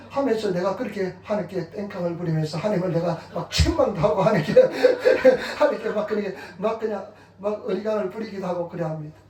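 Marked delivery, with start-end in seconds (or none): 4.6-5.5 a short, loud, breathy laugh; real burst of laughter. Real, not acted. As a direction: laugh out at your own cheek. Laughing mid-sermon about own pleading.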